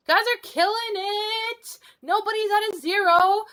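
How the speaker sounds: overexcited over-caffeinated cheer; too much caffeine. Real, not acted. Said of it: "the caffeine has kicked in too much".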